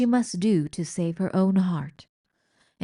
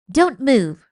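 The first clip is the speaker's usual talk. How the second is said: stern command; 5 semitones higher, 9 dB louder than usual. Acted. Mouse threatens rat with needle.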